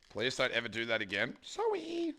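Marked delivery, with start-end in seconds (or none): 1.4-2.1 very high, cutesy sorry; squeaky sorry. Pretend. Streamer apologising to hurt friend, squeaky.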